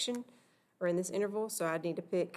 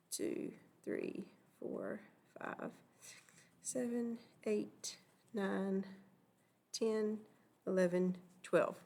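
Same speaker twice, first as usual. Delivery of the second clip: murmured counting; 6 dB quieter, much slower than usual. Real, not acted. Counting under breath while writing.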